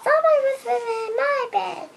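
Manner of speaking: very high, quick mama bear voice. Acted. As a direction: squeak it out fast as the mother bear. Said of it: "And Mama said".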